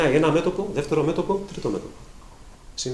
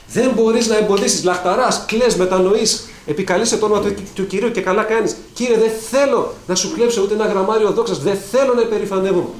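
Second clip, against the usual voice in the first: preaching at full force; 8 semitones higher, 7 dB louder than usual. Acted. Preacher urging the faithful to tame the body, fiery. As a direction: drive each question home from the pulpit. Preacher voicing a believer's prayer.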